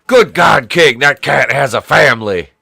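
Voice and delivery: loud, hammed-up ringside outcry; wrestling commentator. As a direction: cry out in horror like a wrestling announcer. Mimics wrestling announcer about cat.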